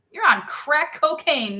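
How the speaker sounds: loud playful disbelief; mock scolding. Real, not acted. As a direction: tell them off playfully, drawn out. Jokingly scolds chat over compliment.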